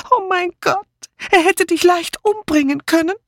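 high, agitated outburst of recognition; shrill with alarm, voice jumping up; excited cry. A narrator's reading. Dora to Letty, recognizing the dead man: excited, alarmed. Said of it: "rief Dora Banner aufgeregt".

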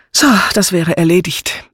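contented declaration; satisfied. A narrator's reading. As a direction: announce it pleased, job done. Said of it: "erklärte sie zufrieden".

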